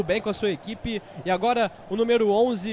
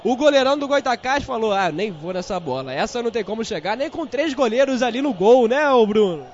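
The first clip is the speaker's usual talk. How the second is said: excited commentary; 5 semitones higher, 6 dB louder than usual. Acted. Excited replay talk after goal.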